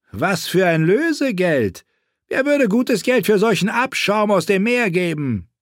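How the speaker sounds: mock her with crude contempt; crude knight. A narrator's reading. Sachs scornful.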